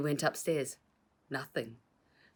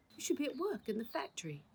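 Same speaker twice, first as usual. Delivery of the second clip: whispered, higher scolding; 11 semitones higher, 3 dB quieter than usual. Acted. Uncle scolding the crooks in a whisper. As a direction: hiss the reproach under breath.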